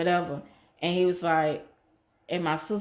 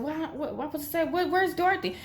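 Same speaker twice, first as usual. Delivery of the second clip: much higher, laughing, flustered; high friend voice; 9 semitones higher than usual. Retold or imitated. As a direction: play the friend all in a flap. Friend's voice quoting dad.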